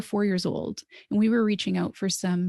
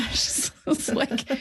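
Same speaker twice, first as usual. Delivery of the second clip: normal talking voice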